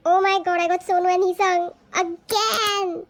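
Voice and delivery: loud, thrilled announcement; real fan excitement; excited disbelief. Real, not acted. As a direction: exclaim over an unbelievable lucky repeat. Repeat lucky pull, gushing.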